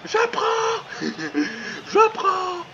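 high laughing imitation, je prends; mock quote laughing. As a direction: imite en riant, très aigu. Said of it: "Il a dit, à ma vie il a dit". (citation imitée en riant, voix aiguë)

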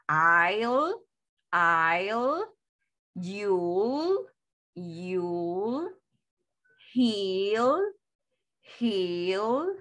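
"I'll, you'll, he'll, she'll" each stretched slowly with a rise; modelo de pronunciación. Shown as a demonstration. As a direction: draw out "I'll, you'll, he'll, she'll", gliding upward to the final l. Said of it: "esta se pronuncia". ("I'll, you'll, he'll, she'll" alargadas, con tono ascendente)

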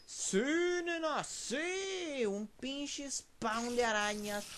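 alargado, agudo y emocionado; grito eufórico. Real, not acted. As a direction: celebra a gritos. Euforia al encontrar el spawn. (euforia agudo y alargado)

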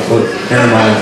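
normal talking voice

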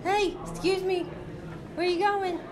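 high teasing scold. Real, not acted. Scolding toddler playfully.

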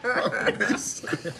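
talking through big laughs; can barely get the words out laughing. Real, not acted. Laughing at funny faces on camera.

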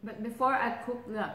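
chewing while talking; muffled by food; mouth full. Real, not acted. Seen 0: Woman on left has full puffed cheeks, chews between words.

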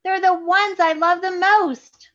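put-on high, loud, slow plea from a boy; pleading cry. A narrator's reading. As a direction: beg high and loud for them back. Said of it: "cried Charlie".

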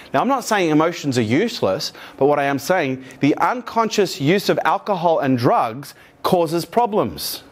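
normal talking voice

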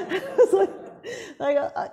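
giggling through the words; real laughter breaking the words; laughing. Real, not acted. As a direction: laugh it out, caught off guard. Laughing at teacher's guess.

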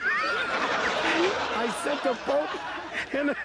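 high, slow, laughing through the words; can't get it out for giggling; cracking up. Real, not acted. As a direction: repeat the punchline while breaking into laughter. Repeats punchline, cracking up.

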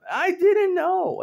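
high, loud and slow, drawn out; exaggerated disbelief. Vlogger learns of an old crush, high loud disbelief. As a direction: wail it out in mock shock. Repeated for comic effect.

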